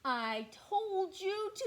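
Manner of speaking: gruff bear crying out. Acted. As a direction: raise the voice at the pest. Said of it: "cried Bear".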